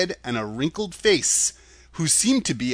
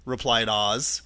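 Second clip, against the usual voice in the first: ordinary delivery, nothing marked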